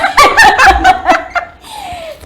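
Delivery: a sudden high real laugh; high burst of laughter. Real, not acted. Laughing at herself after mentioning her grandfather.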